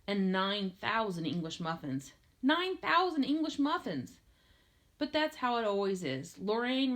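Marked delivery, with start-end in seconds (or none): 2.4-4.1 high-pitched, astonished repeat; amazed exclamation. A narrator's reading. Narrator marvelling at the hoarded muffins, amazed exclamation. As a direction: exclaim the absurd number in a raised pitch.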